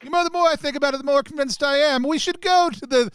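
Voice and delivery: put-on high, loud grand noble accent; high, loud put-on aristocrat; Duchess accent. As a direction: declare it like a proud duchess. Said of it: "in that accent".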